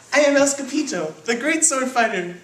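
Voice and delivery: high, loud boastful announcement; hammed-up little hero voice; kitten swordfighter. A narrator's reading. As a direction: proclaim yourself a great hero, pitched high. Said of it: "said Skippy John Jones".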